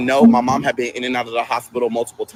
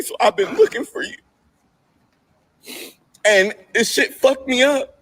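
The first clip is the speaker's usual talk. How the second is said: upset, on the edge of tears; 10 semitones higher than usual. Real, not acted. Emotional high strained voice.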